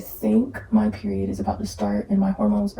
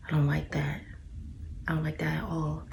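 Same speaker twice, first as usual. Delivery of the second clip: whisper; 4 semitones lower, 11 dB quieter than usual. Real, not acted. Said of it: "Sound like a man".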